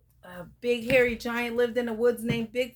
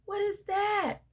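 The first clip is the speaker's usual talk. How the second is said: scared question; 11 semitones higher than usual. Acted. Pete scared at night.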